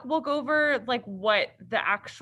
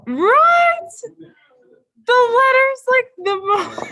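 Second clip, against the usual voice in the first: laughing; 14 semitones higher, 10 dB louder than usual. Real, not acted. Laughing at a funny email.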